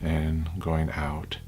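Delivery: unhurried, hushed guidance, phrase by phrase; guided meditation. Acted.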